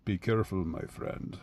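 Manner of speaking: a shady stranger's quiet threat; slightly sinister. Retold or imitated. Sinister stranger warning the listener, hushed menace. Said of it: "somebody with an accent, looking slightly sinister".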